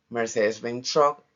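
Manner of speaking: ordinary talk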